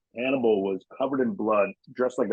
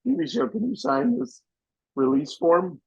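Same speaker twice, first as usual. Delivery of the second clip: high, eager mock voice; squeaky pleading impression; high imitating the PA. PA asking a star to sign, high mock voice. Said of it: "he's like".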